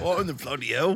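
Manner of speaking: high, hammed-up British exclamation; mock British bafflement. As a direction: play an incredulous Brit, laughing. Mimicking a baffled British friend.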